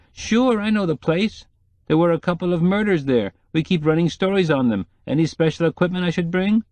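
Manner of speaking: lightly voiced hungry photographer; photographer's voice. Odd Bunsen recalls murders.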